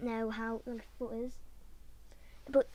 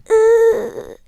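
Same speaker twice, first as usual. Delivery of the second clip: loud high strained screech; long high strangled noise; imitated strained scream; 9 semitones higher, 18 dB louder than usual. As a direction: demonstrate a choked, held scream. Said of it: "it's like a".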